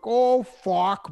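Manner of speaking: hammed-up Canadian accent. Mimicking a hockey player's put-on voice, hammed-up Canadian accent. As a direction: ham up the Canadian twang. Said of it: "he really puts on the voice".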